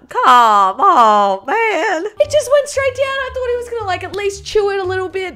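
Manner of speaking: high, loud disbelief; shocked exclaiming. Real, not acted. Vlogger shocked as man swallows banana, exclaiming. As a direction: cry out in amazement.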